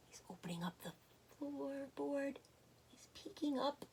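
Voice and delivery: whispered commentary to the listener; quiet suspenseful aside; hushed aside. Real, not acted. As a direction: whisper so as not to wake the fox. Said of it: "narrator whispers aside to listeners".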